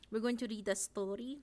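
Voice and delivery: funny, muddled Romanian-ish accent; vaguely Romanian accent. Shown as a demonstration. Streamer failing at Russian, vaguely Romanian accent. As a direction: read it in a comic Romanian accent. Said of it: "my really funny one that I always go to".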